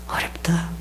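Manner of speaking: very soft, voiceless aside; hushed admission. Acted. Preacher voicing a discouraged memory of evangelism, whispered. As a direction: whisper it, barely audible. Echoing the congregation's discouraged answer.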